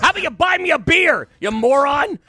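loud jeering bar heckler; heckler yelling. Retold or imitated. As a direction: yell it like a rude fan.

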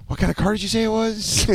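high, soft hesitant question; high-pitched, unsure query; clueless valet. Retold or imitated. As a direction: imitate a sheepish valet. Clueless valet asking about car.

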